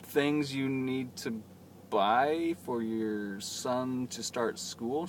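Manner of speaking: slow, spaced-out words, as retold; slow clarifying question. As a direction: spell it out slowly for someone with little English. Slow clarifying to non-native speaker.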